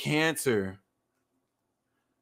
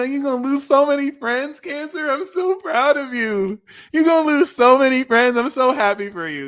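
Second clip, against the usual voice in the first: high, loud sarcastic congratulations; mock cheer; 10 semitones higher, 6 dB louder than usual.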